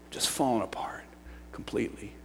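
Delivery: near-whispered, soft and low; hushed aftermath. Real, not acted. Preacher recalling his life falling apart, hushed. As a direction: let it drop to a hush.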